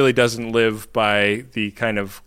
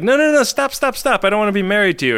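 high-pitched, rapid-fire objection, louder at first; mock-panicked pleading to stop, easing at the end; retold frantic protest; 10 semitones higher, 5 dB louder, faster than usual. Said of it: "she's like".

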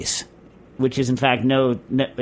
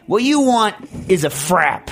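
imitated counterman, slow and higher, louder on the verdict; emphatic counterman; 5 semitones higher, 4 dB louder, slower than usual. Counterman telling a customer what he wants, emphatic. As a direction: spell it out slowly, landing on "frap".

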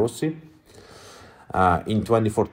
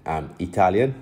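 ordinary talk

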